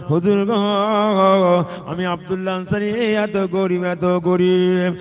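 wailing chanted lament with long-held words; tearful plea. Retold or imitated. Poor Ansari confesses poverty to the Prophet, chanted tearful lament. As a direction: plead melodically, often high, as if weeping. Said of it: "কাঁদতে শুরু করলো".

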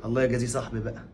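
soft, low embarrassed apology; subdued, remorseful low voice; ashamed. Acted. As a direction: admit your shame quietly. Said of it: "انا مكسوف منك قوي".

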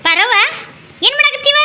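high call to a neighbour; high greeting to the woman cleaning greens. Acted. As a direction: call out across the yard.

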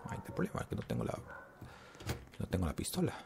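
susurrado, sin voz, casi para sí mismo; suéltalo en un susurro preocupado; susurro real. Real, not acted. Le falta la pistola en el juego. (el jugador se da cuenta de que le falta la pistola, susurrando)

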